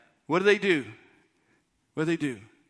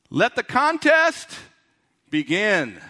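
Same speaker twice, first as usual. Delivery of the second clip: ring announcer; slower than usual. Acted. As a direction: stretch out "contest" like opening a fight. Preacher opens a mock bout.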